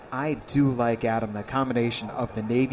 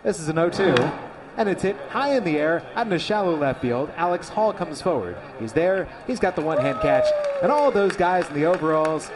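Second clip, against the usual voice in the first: play-by-play; 3 semitones higher than usual. Acted. Live baseball call.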